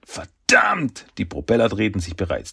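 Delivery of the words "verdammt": high-pitched cry of dismay; alarmed curse. Acted. Fluch in der Falle. (entsetzter, hoher Fluch)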